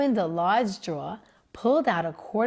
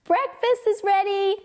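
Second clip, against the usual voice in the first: high, louder mother voice; mother calling breakfast; 12 semitones higher, 5 dB louder than usual. A narrator's reading. Mother telling Joe breakfast is ready, high. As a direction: announce it brightly. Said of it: "said his mother".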